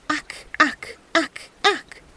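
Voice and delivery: high, odd croak; frog croaking. A narrator's reading. Frog crying after the departing peasant, high croak. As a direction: croak after him mockingly. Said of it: "the frog still crying out after him".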